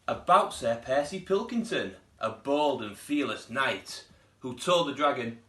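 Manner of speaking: lively read-aloud storytelling voice; storybook narration. A narrator's reading. Teacher reading a picture book to pupils, lively storytelling. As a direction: read it like story time for little ones.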